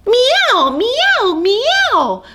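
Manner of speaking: high, loud excited meows; loud kitten mews. Acted. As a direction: kittens yowling at a mouse.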